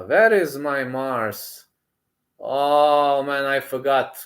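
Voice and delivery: usual speaking voice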